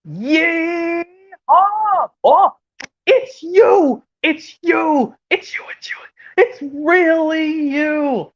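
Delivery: high, squealing excitement; overjoyed cartoon character. Cartoon fan seeing who it really is, overjoyed. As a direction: gush in a very high voice, stretching the last line. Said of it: "how about this impression after this one where it goes".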